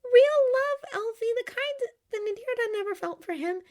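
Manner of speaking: put-on high-pitched, emphatic young woman; high, heartfelt girlish retelling; girlish Glinda, upset. A narrator's reading. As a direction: pour it out in a high voice. Glinda's character voice.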